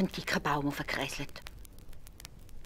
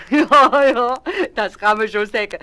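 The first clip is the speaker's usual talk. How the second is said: laughing reply; 6 semitones higher, 14 dB louder, slower than usual. A narrator's reading. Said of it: "lacht der Ali Baba".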